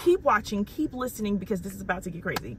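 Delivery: lively, emphatic sponsor pitch; upbeat ad read. Real, not acted. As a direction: sell it with real enthusiasm. Sponsor read with contest announcement.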